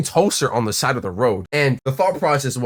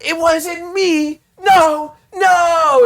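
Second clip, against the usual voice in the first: high, drawn-out mock whine; boy caught by the teacher pleading innocence in a high, drawn-out whine; high pleading denial; 5 dB louder, much slower than usual. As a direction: play the grabbed boy begging to be let go. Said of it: "he just explained, like".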